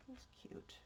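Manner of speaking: soft whisper to herself. Real, not acted. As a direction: murmur it under your breath. Quiet crafting aside.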